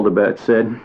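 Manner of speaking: ordinary delivery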